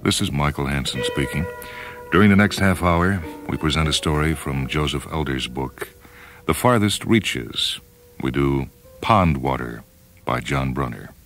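ordinary delivery

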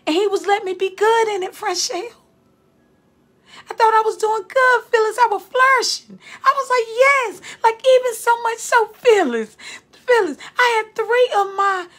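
high, loud and elated, close to tears; excited testimony. Real, not acted. Preacher telling the church about her thriving classroom, elated and loud. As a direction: pour out the joy of success, rising and ringing.

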